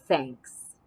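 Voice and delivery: lightly voiced gruff bear; a hint of a shy bear; low bear voice.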